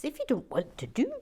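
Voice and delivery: swooping Canadian lilt ending on a loud eh; quick, hammed-up Canadian accent; mock Canadian accent. Reading the viewer's opening line, mock Canadian accent. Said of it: "a Canadian accent".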